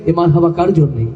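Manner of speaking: ordinary delivery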